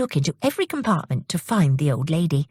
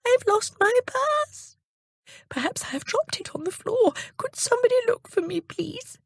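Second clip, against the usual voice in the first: put-on high old lady's voice, anxious and pleading; worried old lady. Old lady asks passengers to find her purse, high and anxious. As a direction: plead with the carriage for help. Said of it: "heard the voice say".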